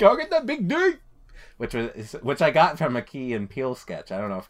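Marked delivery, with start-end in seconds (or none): 0.0-0.9 high, loud comic rallying cry; mock yelled catchphrase. Gamer retelling his trash talk from a sketch, high mock yell. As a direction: belt it high like a silly sports chant.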